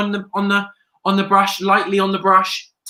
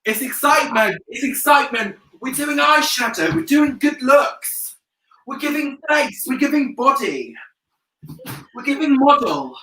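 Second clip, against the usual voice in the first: excited hype; 5 semitones higher than usual. Real, not acted. Hyping makeup look to viewers.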